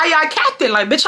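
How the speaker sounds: normal talking voice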